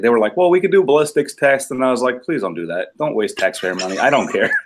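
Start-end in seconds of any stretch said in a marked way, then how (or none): none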